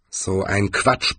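slow, mildly grumbled dismissal; understated slow grumble; grumbling. A narrator's reading. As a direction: grumble it slowly in annoyance. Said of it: "brummte Rincewind".

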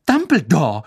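fast, loud, indignant; agitated. Acted. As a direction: protest heatedly. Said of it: "sagte Professor McGonagall aufgebracht".

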